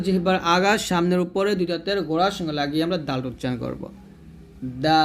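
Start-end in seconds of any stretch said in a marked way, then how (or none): 4.8-5.0 দাল with a light, very long "aa" closing on l; tongue tip starts দাল, then hold the vowel for several seconds; sustained, steady, thin দাল; thin four-alif stretch. Shown as a demonstration. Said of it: "এরকম".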